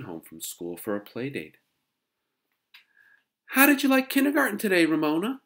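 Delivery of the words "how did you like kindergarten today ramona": very high, loud, quick schoolgirl chirp; bright teasing tone. A narrator's reading. Sister's friend needles girl who skipped school, bright tone. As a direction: ask with pointed, exaggerated brightness. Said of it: "in a bright tone".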